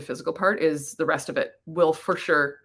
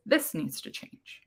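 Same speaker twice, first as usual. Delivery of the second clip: slow, softer echo of the warning; quiet insistence; 7 dB quieter, slower than usual. Pretend. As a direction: let it come out slow and soft. The rest of you says it.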